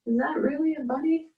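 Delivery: high, fast, amazed question; acting out her first surprised look. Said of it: "That was my feeling when I first".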